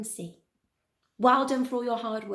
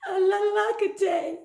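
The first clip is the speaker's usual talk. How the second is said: high, slow storybook wail; crying out; 7 semitones higher, much slower than usual. A narrator's reading. Story character lamenting, high drawn-out cry. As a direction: draw it out in a mild wail. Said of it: "And they cried".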